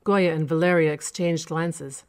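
plain reading voice

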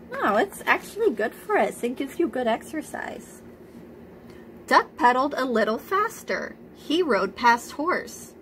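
ordinary delivery, nothing marked

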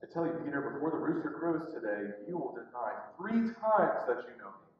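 low, soft, solemn voice; grave prediction. Retold or imitated. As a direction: foretell it quietly and gravely. Jesus foretells Peter's denial.

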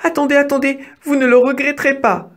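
high-pitched, insistent plea; urgent mouse cry. A narrator's reading. Mouse asking the hungry wolf to wait, high and urgent. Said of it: "s'écria Didi".

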